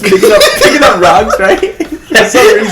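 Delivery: chuckling through words; laughing while telling. Real, not acted.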